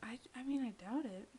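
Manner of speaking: hushed, unvoiced doubt; whispering. Real, not acted. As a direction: breathe it out as a secret. Teasing whisper after same stories.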